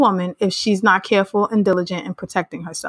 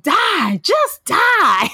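higher, louder mock plea to die; mock dramatic Marion; 11 semitones higher, 3 dB louder, much slower than usual. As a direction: ham up a melodramatic death wish. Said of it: "Marion was like".

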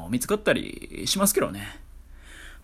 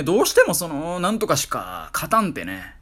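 usual speaking voice

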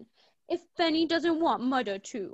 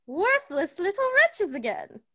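ordinary delivery

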